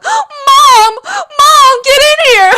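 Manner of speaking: high, loud crying shout for mom; panicked crying call. Retold or imitated. Retelling how she screamed for her mom after falling. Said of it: "crying and freaking out".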